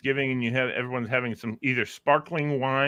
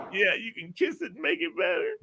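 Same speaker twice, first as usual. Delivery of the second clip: high, softer strained falsetto; mock pained plea; 13 semitones higher, 5 dB quieter than usual. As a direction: whimper it in hammed-up pain. Repeats injured man's answer to trooper.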